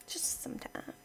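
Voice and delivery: breathy whisper, barely there; whispered aside. Real, not acted. Gamer whispering a sly aside to viewers. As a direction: drop to a secret whisper.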